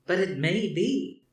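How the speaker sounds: a cheeky last word; young woman's voice. A narrator's reading.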